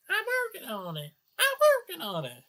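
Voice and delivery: high, loud whiny voice; Chris Chan impression. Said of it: "Obligatory Chris Chan reference".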